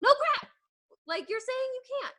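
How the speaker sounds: high, incredulous no crap; exasperated. Real, not acted. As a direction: scoff at the excuse. Exasperated rant at complainers.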